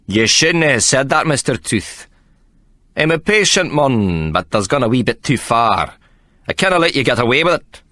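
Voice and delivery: Scottish fighter about to strike; Scottish menace. A narrator's reading. Figgins before striking Tooth.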